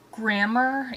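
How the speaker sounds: normal talking voice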